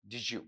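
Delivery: "डिजू" softly, d and y merged into a j sound; blended diju. Shown as a demonstration. Teacher repeating the connected-speech sound. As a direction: repeat "डिजू" quietly as one word. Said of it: "ऐसा पढ़ा जा सकता है".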